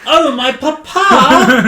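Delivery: high, loud Cockney urchin wail; hammed-up Cockney kid, stretched out slowly; Cockney child. Oliver Twist kid crying for papa, Cockney child accent. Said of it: "this little Cockney child accent".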